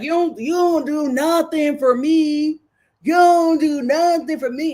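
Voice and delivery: hammed-up boyfriend retort; imitating him. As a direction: play the lazy boyfriend whining back. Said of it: "And he was like".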